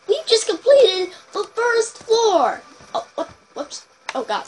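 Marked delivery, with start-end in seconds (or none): 0.1-2.6 high, loud, slow triumph; excited. Real, not acted. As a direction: announce it thrilled. Triumph in game.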